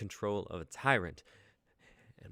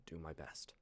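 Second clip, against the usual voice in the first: whispered; 3 semitones lower, 15 dB quieter than usual. Acted.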